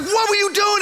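can't believe what he just saw; asking his friend what on earth that was; incredulous. Retold or imitated.

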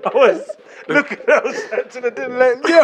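high, loud laughing words; can't stop laughing. Real, not acted. Laughing while telling.

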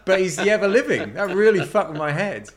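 normal talking voice

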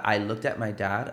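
ordinary talk

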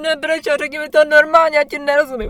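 high, loud exasperated parent; imitating her own fed-up shouting. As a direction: yell at a crying kid to speak normally. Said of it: "Říkám".

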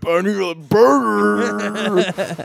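very drawn-out caricature American; mock American accent. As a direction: stretch the words as a cartoon American accent. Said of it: "other countries' American accents".

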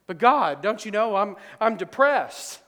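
high, loud complaining Elijah; whiny Elijah. Acted. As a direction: whine it at God like a desperate prophet. Elijah voiced complaining to God.

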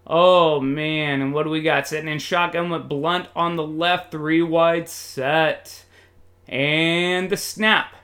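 ordinary talk

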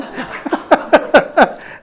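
airy, high chuckle; breathy laugh. Real, not acted. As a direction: laugh silently at your own joke. Laughs at own joke.

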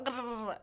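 quick burst of garbled noise; imitated rapid babble. Mimics her racing thoughts.

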